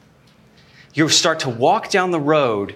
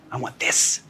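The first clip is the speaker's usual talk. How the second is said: breathless whisper of wanting; whispered craving; 5 dB quieter than usual. Acted. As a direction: whisper it like a secret greed. Preacher voicing a stubborn heart.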